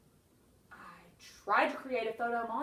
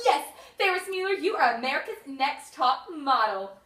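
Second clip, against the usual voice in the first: cheering; 2 semitones higher, 12 dB louder than usual. Real, not acted. Owner cheering her cat at two a.m., loud and triumphant.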